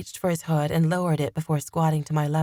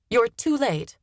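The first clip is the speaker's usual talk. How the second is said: higher, sharp retort; snapping; 6 semitones higher than usual. A narrator's reading. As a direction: snap it back at the captor. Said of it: "I snapped".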